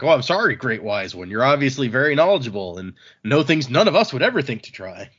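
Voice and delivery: exaggerated sarcastic praise, lively pitch swings; sarcastic retort. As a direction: imitate someone laying on sarcastic deference. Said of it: "he gets all sarcastic".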